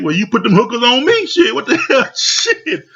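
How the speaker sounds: incredulous country guy throwing it back at her; mock indignant retort. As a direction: play the insulted man, cracking up as you fire back. Retold quarrel, [name] retorts.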